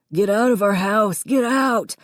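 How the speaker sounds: put-on boy screaming at Connor; screamed. A narrator's reading. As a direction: scream him out of the house. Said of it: "Davy screamed".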